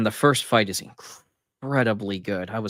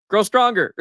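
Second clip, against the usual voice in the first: curt dismissal; 10 semitones higher, 6 dB louder than usual. Retold or imitated. Viking shrugs off a complaint.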